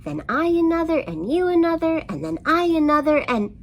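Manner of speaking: the mouse winds himself up, pitch climbing to a squeak; annoyed rant. A narrator's reading. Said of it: "the mouse said with annoyance".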